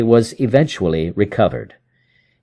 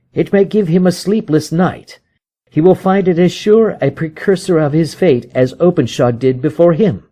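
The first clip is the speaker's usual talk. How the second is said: higher, vengeful detective voice; Holmes, grim satisfaction; 8 semitones higher, 3 dB louder than usual. Acted. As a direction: savour the plan.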